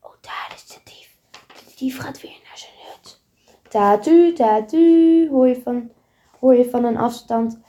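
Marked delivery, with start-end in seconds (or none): 0.0-3.2 soft unvoiced whisper. Goes hiding behind a tree, whispering. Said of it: "fluistert Goes". 3.7-5.3 siren imitation. Police siren heard.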